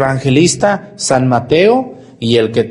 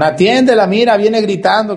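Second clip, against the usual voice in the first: urging plea; 8 semitones higher than usual. Acted. Disciples urging Jesus, quoted.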